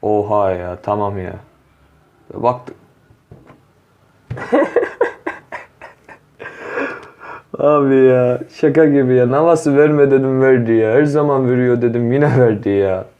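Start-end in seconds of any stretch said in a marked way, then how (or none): 4.3-5.6 short breathy laugh of disbelief; exasperated laugh; disbelieving laugh. Real, not acted. Pack pull gives unwanted player again. 7.6-13.1 louder, higher exasperated ranting; real frustration, raised voice; frustrated complaint. Real, not acted. Keeper pulled again despite complaint.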